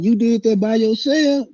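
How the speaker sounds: high incredulous ask; amazed question. Retold or imitated. Rival's mother asking the teller, high incredulous. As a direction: ask in disbelief. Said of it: "he was like".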